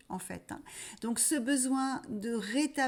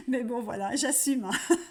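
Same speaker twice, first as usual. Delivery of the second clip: laughing at herself; 3 semitones higher, 6 dB louder, faster than usual. Real, not acted. She apologises to viewers for her accent, laughing. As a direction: laugh it off, owning the bad accent.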